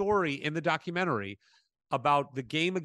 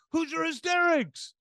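mock fan call-out; 14 semitones higher, 3 dB louder than usual. Fans calling out in line.

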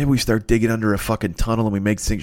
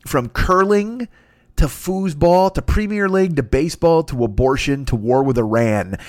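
normal talking voice

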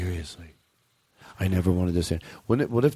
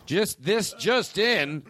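high, punchy breaking-news announcement; mock newscaster; 8 semitones higher, much slower than usual. Host turning the bit into a news story, mock newscaster. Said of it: "it's a news story for me".

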